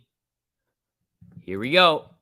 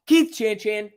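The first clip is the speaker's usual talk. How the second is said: put-on voice; 10 semitones higher, 4 dB louder, slower than usual. Greeting donor in put-on voice.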